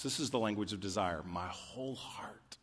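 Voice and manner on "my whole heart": whispered prayer. Acted. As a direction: whisper it, soft and intimate. Intimate paraphrase of prayer.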